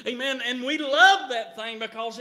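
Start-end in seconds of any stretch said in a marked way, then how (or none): none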